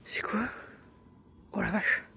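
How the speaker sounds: frightened breathy whisper; scared whisper. Real, not acted. Scared alone at night.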